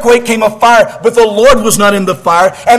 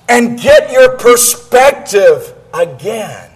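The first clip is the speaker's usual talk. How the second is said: preaching shout; 3 semitones higher, slower than usual. Acted. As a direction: shout it down at him, drawing each word out. God rebukes Elijah, preached shout.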